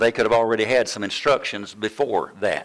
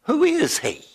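retold indignation. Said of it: "I was mad".

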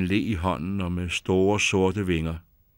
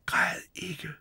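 soft whisper of comfort; 8 semitones higher, 8 dB quieter than usual. A narrator's reading. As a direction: whisper to soothe her. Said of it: "hviskede han".

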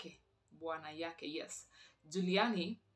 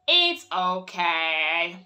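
drawn out; 11 dB louder, much slower than usual. Real, not acted. To herself about a noise, drawn out slowly. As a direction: stretch "it's okay" very slowly and loudly.

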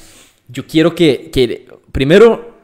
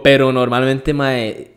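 ordinary talk

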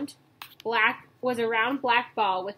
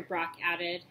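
ordinary delivery, nothing marked

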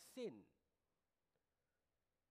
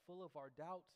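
usual speaking voice

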